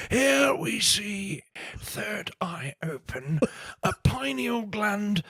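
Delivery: slow hushed nature documentary whisper; David Attenborough. Pretend. Host asks for Attenborough, slow whispered documentary narration. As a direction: narrate the tune like wildlife on film, slow. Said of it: "in the style of David Attenborough".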